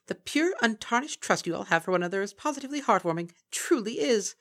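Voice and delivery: high, loud, sly creature voice; mocking Kelpie. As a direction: purr false praise like a gleeful monster. Kelpie creature taunting the mares.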